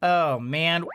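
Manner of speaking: ordinary delivery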